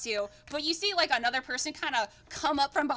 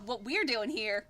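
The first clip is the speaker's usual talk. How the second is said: usual speaking voice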